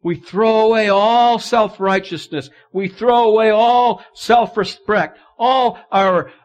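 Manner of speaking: loud, high, full-force preaching. Acted. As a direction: preach it out to the congregation.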